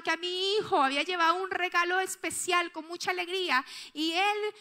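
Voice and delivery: usual speaking voice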